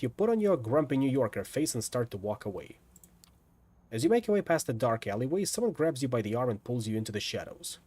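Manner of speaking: ordinary talk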